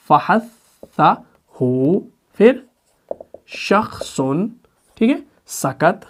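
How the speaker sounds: very slow, broken into parts; syllables separated slowly. Shown as a demonstration. Teacher showing the letters written separately, slow separated recitation. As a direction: draw out and separate each piece. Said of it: "अलग अलग लिखा ना".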